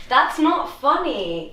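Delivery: loud, high, drawn-out mock outrage; indignant inner retort. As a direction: act out the unspoken telling-off. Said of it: "in my head, I was thinking".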